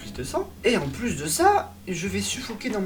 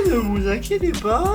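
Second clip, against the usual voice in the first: drowsy doctor; 8 semitones higher, 6 dB louder than usual. Retold or imitated. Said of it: "il disait surtout".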